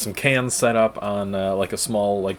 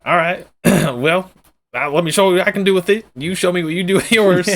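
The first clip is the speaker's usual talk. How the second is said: higher, louder eager cowboy; excited NPC voice; 7 semitones higher, 4 dB louder than usual. Pretend. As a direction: show off like a proud gunslinger. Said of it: "He's like".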